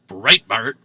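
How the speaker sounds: mock burp. Shown as a demonstration. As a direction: belch the name out loud. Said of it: "while burping".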